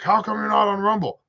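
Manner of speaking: high, whiny mock question; mock nagging. Imitating everybody asking about Rumble, mock nagging. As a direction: pitch it way up, mocking the askers.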